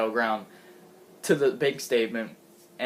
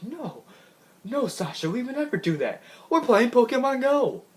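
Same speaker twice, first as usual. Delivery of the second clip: Goldust voice; 9 semitones higher than usual. Retold or imitated. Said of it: "Goldust is like".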